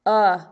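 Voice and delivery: "uh" isolated and loud; short U sound. Shown as a demonstration. Teacher isolates the vowel of tub, a loud "uh". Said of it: "So we hear the".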